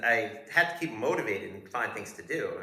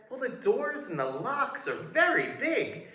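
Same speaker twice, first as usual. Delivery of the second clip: fawning guard voice. Retold or imitated. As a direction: gush like an overeager doorman. Guard voice, footlong key.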